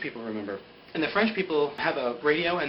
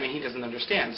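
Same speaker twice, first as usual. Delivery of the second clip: usual speaking voice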